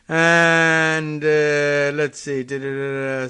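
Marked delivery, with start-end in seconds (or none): none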